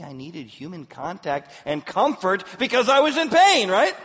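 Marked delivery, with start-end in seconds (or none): 1.9-3.9 loud, high exasperated outburst; mock indignation. Daughter reacting to mother's story, mock indignation. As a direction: blurt it out like the obvious answer.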